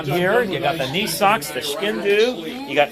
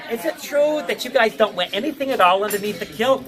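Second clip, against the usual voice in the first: put-on higher young American girl voice, curious; young American tourist asking a cheeky question, higher; 8 semitones higher, 3 dB louder than usual. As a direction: play the nosy young tourist, pitched up. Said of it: "She says".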